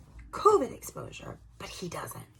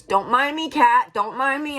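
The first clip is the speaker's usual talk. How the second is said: raised, higher-pitched interjection; aside to cat; 5 semitones higher, 6 dB louder, slower than usual. Real, not acted. Talker interrupting her story for the cat, raised voice. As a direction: break off and call out playfully.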